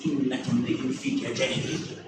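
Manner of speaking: fast, soft Arabic quotation of the Prophet; rapid quiet Arabic quote. Shown as a demonstration. Preacher quoting the Prophet to the congregation, fast and soft. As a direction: rattle off the Arabic hadith quietly. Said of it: "He said".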